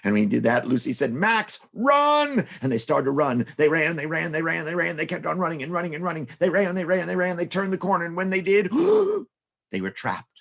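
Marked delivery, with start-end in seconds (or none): 1.1-2.5 high, loud cry to run; urgent shout. Acted. Sister warns of cat. 8.8-9.2 high startled gasp; sudden frightened gasp; sharp gasp. Acted. Mice find themselves trapped.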